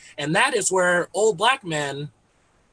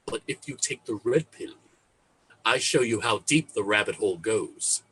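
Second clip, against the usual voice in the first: low, soft, measured movie-mentor voice; Lawrence Fishburne as Morpheus; 7 semitones lower, 5 dB quieter than usual. Acted. Morpheus offering Neo the two pills, low, soft and solemn. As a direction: deliver it low and grave, with weighty pauses. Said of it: "Mister Lawrence Fishburne was like".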